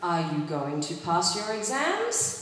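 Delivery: low start, gradual climb up to "exams"; rising question intonation. Shown as a demonstration. Teacher models a yes-no question for repetition, rising tune on "exams". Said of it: "our intonation goes up".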